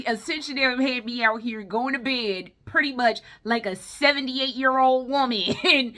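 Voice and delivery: ordinary talk, nothing marked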